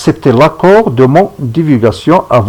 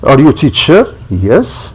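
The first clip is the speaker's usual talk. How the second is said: French-accented English, rising question then answer; accent français en anglais. Real, not acted. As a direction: read English with a francophone accent. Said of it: "mon accent en anglais". (anglais à l'accent francophone)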